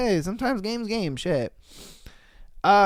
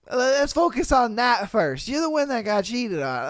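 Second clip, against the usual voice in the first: usual speaking voice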